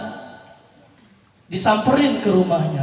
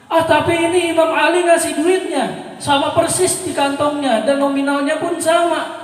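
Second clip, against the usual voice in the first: bewildered protest; 8 semitones higher, 4 dB louder than usual. Retold or imitated. Husband protests in confusion.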